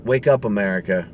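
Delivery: normal talking voice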